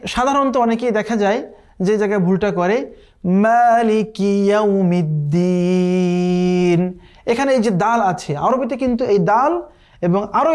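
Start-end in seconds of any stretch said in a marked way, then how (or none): 3.2-7.0 slow, drawn-out melodic tajweed chant; chanted Quranic recitation. Shown as a demonstration. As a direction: recite the verse slowly with full tajweed.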